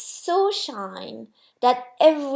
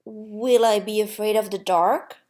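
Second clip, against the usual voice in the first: ordinary delivery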